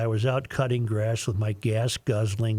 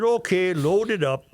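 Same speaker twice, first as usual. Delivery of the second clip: higher, lightly Norwegian pilot; Norwegian accent; 6 semitones higher than usual. Pretend. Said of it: "use your worst Norwegian voice".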